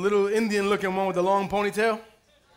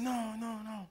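put-on small kid voice; 2 semitones lower, 18 dB quieter than usual. Pastor voices small godson.

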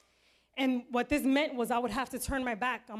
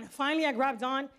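plain reading voice

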